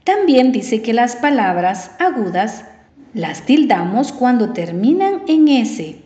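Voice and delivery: ordinary talk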